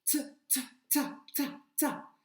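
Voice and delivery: short detached "ta", repeated evenly; syllabe répétée isolée. Shown as a demonstration. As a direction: break off "T", then repeat "ta" as separate beats. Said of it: "La première syllabe, c'est ta". (« ta » bref et détaché, répété)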